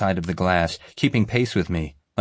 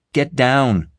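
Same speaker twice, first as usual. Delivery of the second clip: louder urgent warning; 6 semitones higher, 5 dB louder than usual. A narrator's reading. The narrator warning the angry woman, loud and urgent. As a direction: bark it at her urgently. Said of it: "I warned her".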